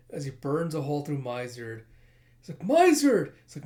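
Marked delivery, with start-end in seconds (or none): 2.6-3.3 high, loud cry of horror; anguished shout. Acted. Companion seeing Miser burned through, horrified scream. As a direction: scream a falling friend's name.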